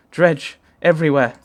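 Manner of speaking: a hint of a Scottish hunter; Scottish accent. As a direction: blurt the grim news in a Scottish accent. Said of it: "and Rook's Scottish".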